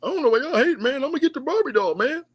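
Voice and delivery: high, silly voice of a fan hyping the doll; mocking the fans. Mocking fans who want the Barbie, high goofy voice. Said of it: "these dudes out here talking about".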